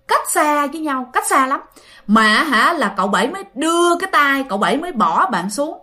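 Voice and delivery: plain reading voice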